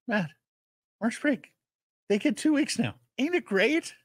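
high, tight, forced cheer through gritted teeth. Retold or imitated. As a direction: imitate a neighbour pretending it's great. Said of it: "Through gritted teeth".

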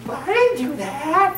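put-on little Johnny tells the teacher he didn't do it, childish fib; little Johnny denying. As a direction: play a kid fibbing to the teacher. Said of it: "what does Johnny say".

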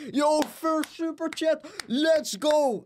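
high-pitched, drawn-out hype shout; excited streamer yell; hyped celebration. Real, not acted. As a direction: cheer it like a big win. Celebrates the first superchat.

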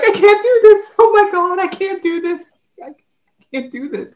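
high, loud, hammed-up sobbing whine; mock crying wail. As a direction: wail it like a big baby, half laughing. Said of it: "I start crying like a big baby".

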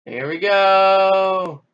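very slow, loud, high cheer; loud, high, slow here we go; drawn-out cheer. Real, not acted. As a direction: stretch it out like a ride. Launching again.

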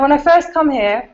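put-on half-hearted go at a Yorkshire accent; attempted Yorkshire accent. Researcher reading out her informant's words, attempted Yorkshire accent. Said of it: "I can't do a Yorkshire accent".

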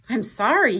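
high, loud, panicky apology; spluttering. Acted. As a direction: blurt it out like a scared dragon. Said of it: "he spluttered".

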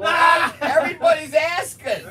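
loud, high excited shout. Real, not acted. Teasing push to watch movie.

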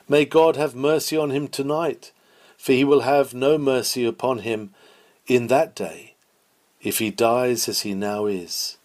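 ordinary talk

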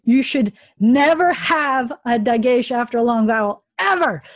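exasperated stress on "never" and "ever"; emphatic insistence. Real, not acted.

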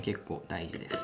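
usual speaking voice

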